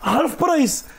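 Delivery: hammed-up tourist English; accent anglais blédard. Shown as a demonstration. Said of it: "anglais blédard". (marchandage en anglais blédard, voix aiguë)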